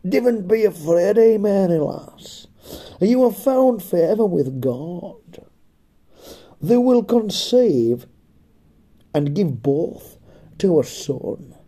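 high, slow, loud Cumbrian angel; broad Cumbrian accent. Pretend. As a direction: announce it slowly in broad Cumbrian. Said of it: "It was in broad Cumbrian".

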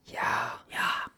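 hushed whisper. Acted. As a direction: keep it whispered. Joins whispered back-and-forth.

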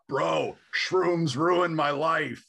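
loud, high, drawn-out bro wail; hammed-up despair, stretching the words out; mock addict lament. Imagining a mushroom addict confessing to a bro, mock anguish. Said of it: "people do you know who are like".

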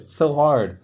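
ordinary delivery

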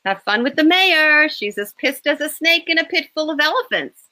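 high, mocking tease; teasing. A narrator's reading. Rachel handing Damien messages, gleeful teasing. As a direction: rib the boss with glee.

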